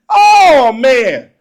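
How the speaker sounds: loud, high drawn-out wail of delight; overjoyed exclamation. Retold or imitated. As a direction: belt it out, overcome with fun. Child overwhelmed by fun.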